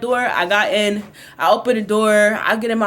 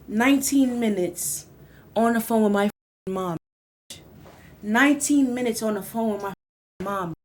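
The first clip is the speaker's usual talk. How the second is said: dread; 2 semitones lower, 4 dB quieter than usual. Acted. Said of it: "slow dramatic dread".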